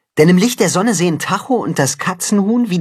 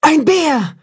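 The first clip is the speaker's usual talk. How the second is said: frightened cry; 13 semitones higher than usual. A narrator's reading. Wolf mother spotting the bear, a frightened high cry. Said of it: "ruft die Wolfsmutter erschrocken".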